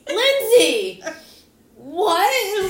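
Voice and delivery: loud, high shocked yell of the name; stunned outburst at the punchline; shocked shout. Real, not acted. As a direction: gasp out the name in disbelief. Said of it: "shocked reaction".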